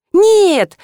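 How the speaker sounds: high, loud protest; выкрик. A narrator's reading. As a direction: schoolgirl crying out a refusal. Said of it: "выкрикнула школьница". (громкий высокий девчачий выкрик)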